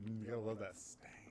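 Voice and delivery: hushed breathy whisper. Acted. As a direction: whisper it like a secret. Sniffing a smelly room.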